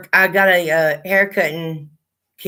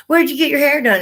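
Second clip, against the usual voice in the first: raised, sing-song mock of a nosy neighbor; 8 semitones higher, 3 dB louder than usual. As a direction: mimic her prying question, pitched up. Mimicking nosy maintenance lady.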